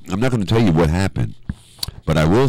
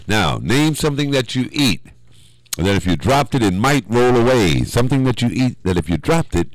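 game show question read; 4 semitones higher than usual. Acted. Host reading the Family Feud question to the callers, announcer style.

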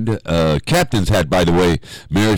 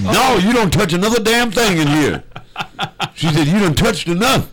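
put-on much higher, scolding old lady, half-laughing; mock angry grandma; 13 semitones higher than usual. Host voicing the grandma who hit him, mock angry scolding.

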